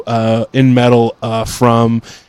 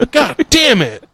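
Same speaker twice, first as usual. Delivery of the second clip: grumbling cook curse; 10 semitones higher, slower than usual. Retold or imitated. Cook's complaint ends.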